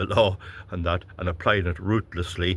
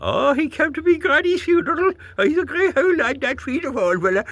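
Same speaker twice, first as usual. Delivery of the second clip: high, louder gushing falsetto; mocking a loyal voter; 5 dB louder than usual. Mimicking a voter praising a Fianna Fáil man, gushing falsetto. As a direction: gush like a starry-eyed party loyalist. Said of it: "The Irish voter, what did?".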